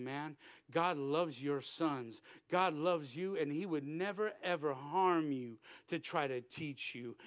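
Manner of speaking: low, soft, deliberate assurance; tender reassurance. Retold or imitated. Preacher telling a grieving father God loves his sons, tender reassurance. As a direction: comfort him with quiet conviction.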